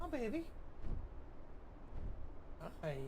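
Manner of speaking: very high, soft cooing. Real, not acted. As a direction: coo softly at a puppy. Fussing over the dog.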